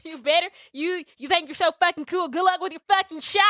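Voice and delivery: put-on high, fast, ranting Kentucky accent; hard Kentucky accent. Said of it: "him yelling at her in that Nebraska".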